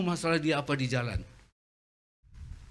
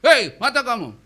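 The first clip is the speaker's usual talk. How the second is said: much higher, loud angry yell; fierce driver; 11 semitones higher, 12 dB louder than usual. Retold or imitated. Angry driver yelling through the window, loud fury. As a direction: bark at him for his eyes. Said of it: "Dia lebih galak lagi".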